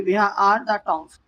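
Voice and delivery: very slow, dictating pace; teacher dictating slowly; slow example read. Shown as a demonstration. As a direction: read each word out slowly to students. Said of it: "tongs आया तो यहाँ पे आर use करेंगे".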